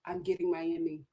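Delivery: low, hushed imitation of the producers; quiet, scheming-producer aside; producers' aside. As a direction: say it softly to yourself, pitch dropped. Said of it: "the producers were just like".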